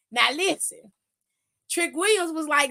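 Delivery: ordinary talk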